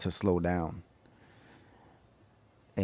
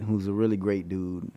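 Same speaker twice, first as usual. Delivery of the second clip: usual speaking voice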